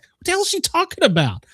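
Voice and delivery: high-pitched retold bewilderment; mock-clueless, voice shooting way up; baffled. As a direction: act out utter confusion, high and incredulous. Retelling own bafflement at the nurses.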